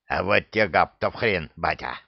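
defiant snarl at father; aggressive. A narrator's reading. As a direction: talk back with bared teeth. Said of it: "Агрессивно оскалился Храт". (агрессивно огрызаясь)

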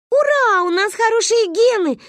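high, loud gleeful cheer; children cheering. A narrator's reading. Daughters clapping at mother's praise, gleeful cheer. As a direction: cheer like delighted little kids. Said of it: "захлопали мы в ладоши".

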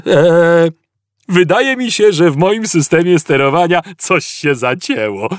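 high, chirpy machine voice; cheerful computer. A narrator's reading. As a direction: announce a fault in a merry sing-out. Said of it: "oznajmił wesoło komputer".

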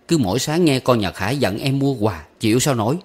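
plain reading voice

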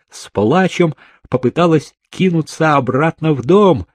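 ordinary delivery

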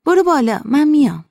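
high, loud urging; urging call. Acted. Urging friend onto the tractor.

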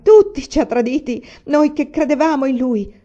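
loud, high anguished cry, narrated sobs; sobbing outcry. A narrator's reading. Son turns away from his father, crying out in anguish. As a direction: cry out in betrayed despair. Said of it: "cominciò a singhiozzare".

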